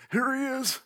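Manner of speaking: high, soft sneer; mocking soldier. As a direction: hand him over with a sneer. Soldiers presenting Jesus to Pilate.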